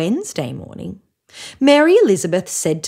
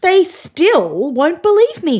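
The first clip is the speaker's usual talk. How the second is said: high, loud, slow frustrated complaint; she's had enough of not being believed; 10 semitones higher, 5 dB louder, slower than usual. A narrator's reading. Mary Elizabeth complains the boys won't listen, exasperated. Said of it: "she said".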